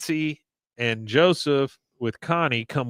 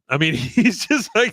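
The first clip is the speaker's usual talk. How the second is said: ordinary talk, nothing marked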